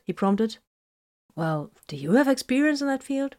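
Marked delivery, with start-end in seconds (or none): 1.4-3.4 higher, listless question; half-hearted. A narrator's reading. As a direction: ask without really caring. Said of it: "Vox asked half-heartedly".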